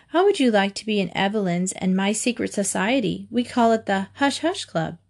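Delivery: usual speaking voice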